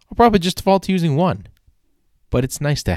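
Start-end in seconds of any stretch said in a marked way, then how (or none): 0.1-1.5 much higher and fast, a quick resigned quip; mock self-answer. Shown as a demonstration. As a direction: toss the answer off high and quick. Answers his own question, mocking.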